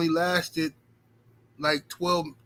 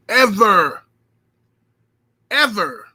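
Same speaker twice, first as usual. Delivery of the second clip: loud drawn-out ever; emphatic shout; 4 semitones higher, 8 dB louder, slower than usual. Real, not acted. Dog owner swearing he'll never let them out, loud. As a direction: yell the ever to drive it home.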